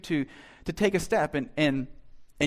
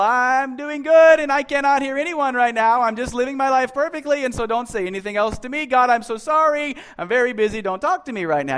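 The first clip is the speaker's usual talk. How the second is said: much higher, louder mock busyness; mock loud busy; 7 semitones higher, 6 dB louder than usual. Shown as a demonstration. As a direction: drown God out loudly. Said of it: "turn up the volume in your life".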